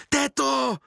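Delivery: high-pitched calling out; excited shout. A narrator's reading. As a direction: cry out high like two children at once. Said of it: "vykřikli naráz Vraždožrava a Pim". (vysoko vykřiknout)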